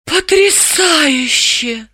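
high, very slow, dazed wonder; drawn-out stunned marvelling; awed murmur. A narrator's reading. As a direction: breathe it out in amazement. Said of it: "прошептала Далила".

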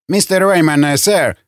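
plain reading voice

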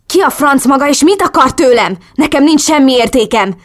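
high, loud, agitated outburst; angry demand. A narrator's reading. Captive yelling at her kidnapper. (dühösen számonkérve, emelt hangon)

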